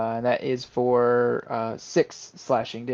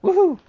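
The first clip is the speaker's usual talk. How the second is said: high, loud woohoo; excited whoop; 8 dB louder than usual. Real, not acted. Player celebrating the hit that was enough, excited whoop. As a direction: let out a high celebratory cheer.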